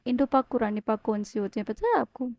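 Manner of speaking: normal talking voice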